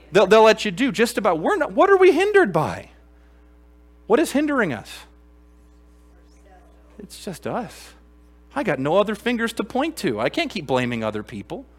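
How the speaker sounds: high, loud impassioned preaching; fiery preaching. Real, not acted. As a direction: preach it at full force. Preaching at full force.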